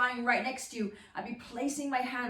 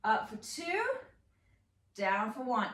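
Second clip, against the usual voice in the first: usual speaking voice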